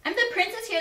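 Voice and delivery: ordinary delivery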